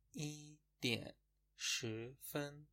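"ای دین شی فن" said very slowly, each syllable stretched; slow model pronunciation. Shown as a demonstration. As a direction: spell it out slowly for learners. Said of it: "به این شکله". (تلفظ شمرده و آهسته)

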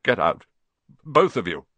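loud, strained croaked order; shocked croaking voice. A narrator's reading. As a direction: croak out a command. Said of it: "croaked Uncle Vernon".

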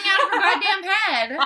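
laughing. Real, not acted. Laughing through the joke.

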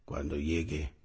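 grave, suave y sobrio; señor del comercial. Retold or imitated. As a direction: habla bajito, sin prisa. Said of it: "el señor caminando en la calle y dice". (imitación sobria del caminante del anuncio)

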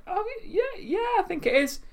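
very high, sheepish yes; admitting it to his mum, high and sheepish; sheepish admission. Retold or imitated. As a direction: caught out, squeak it. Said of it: "I went".

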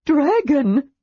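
high, loud awed blurt; the word bursts out at last; stunned gasp of discovery. A narrator's reading. Tass seeing the dwarf is really a dragon, stunned.

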